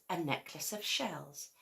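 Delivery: usual speaking voice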